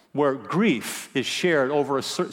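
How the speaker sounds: usual speaking voice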